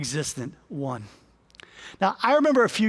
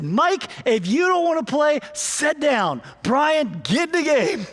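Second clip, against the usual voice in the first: higher, clipped coaching command; stern coach; 6 semitones higher than usual. Retold or imitated. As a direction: snap the order like an impatient coach. Coach scolds Mike, calls Brian.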